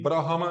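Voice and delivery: ब्रह्मा as three syllables "bra-ha-ma"; full ha, mispronounced. Shown as a demonstration. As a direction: add a vowel after h in ब्रह्मा. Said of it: "ब्रह्मा नहीं".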